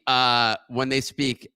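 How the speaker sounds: ordinary talk, nothing marked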